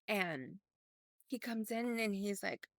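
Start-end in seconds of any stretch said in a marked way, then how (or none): none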